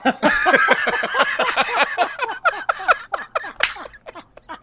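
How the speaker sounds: long burst of high-pitched laughter; real long laugh at a dirty joke. Real, not acted. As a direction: crack up hard. Laughs at punchline.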